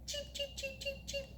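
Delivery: very high, soft chick peeps; baby chick cheeping. Shown as a demonstration. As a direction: peep like a tiny chick. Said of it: "Can you cheep like a baby chick?".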